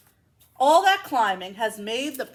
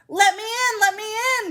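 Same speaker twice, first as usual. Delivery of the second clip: high, loud wolf demand; growling wolf; 12 semitones higher, 6 dB louder than usual. Acted. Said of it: "growled the wolf".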